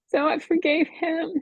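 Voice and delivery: choked up, fighting to get words out; crying. Real, not acted. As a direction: voice breaking into tears, pitch up. Tearful testimony about forgiving husband.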